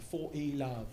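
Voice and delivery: mock-prestigious British umpire's call; prestigious British accent. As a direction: announce the score in a put-on British accent. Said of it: "like, prestigious accent".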